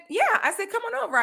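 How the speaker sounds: lifted, cheery invite; mock bright welcome; high friendly greeting.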